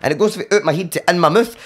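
ordinary talk, nothing marked

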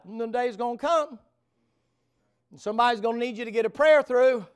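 high, loud pulpit preaching; fiery preaching. Acted. Preacher warning proud listeners about the altar, fiery preaching. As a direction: drive the warning home from the pulpit.

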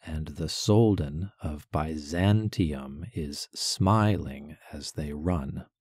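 slow, stress "Soldan", "smiling" and "run"; anapestic scansion. Shown as a demonstration. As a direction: tap out the anapests. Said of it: "Weak, weak, strong, weak".

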